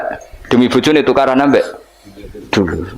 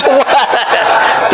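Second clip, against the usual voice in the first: laugh; 13 semitones higher than usual. Real, not acted. Preacher laughs at own joke.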